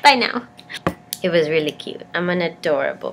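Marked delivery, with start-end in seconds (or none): 0.0-0.5 high bright awkward farewell; awkward chirpy goodbye. Retold or imitated. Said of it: "And I was like".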